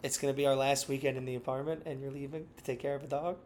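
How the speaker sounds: soft, mock tearful complaint; hammed-up heartbroken whine; sad voice. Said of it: "That's my sad voice".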